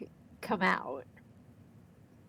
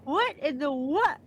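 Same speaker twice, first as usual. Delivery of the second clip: louder take; 5 semitones higher, 4 dB louder than usual. Real, not acted. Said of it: "That was quiet".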